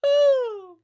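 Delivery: very high, loud woo; whooped cheer. Real, not acted. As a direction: let out a piercing whoop. Wordless cheer on stream.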